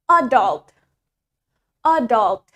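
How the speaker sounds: "adult" stressed on the first syllable, AD-ult; British "adult", punch the opening A, falling off. Shown as a demonstration. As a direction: model "adult" with front stress, slowly. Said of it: "in the front".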